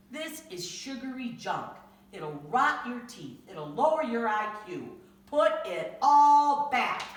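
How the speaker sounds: scolding father. Acted. Father scolding Tyra about junk food, loud stern slow scold. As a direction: lay down the law to a kid.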